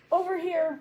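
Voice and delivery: high calling shout across water. Acted. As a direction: call out to be found. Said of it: "Molly heard Susan shout".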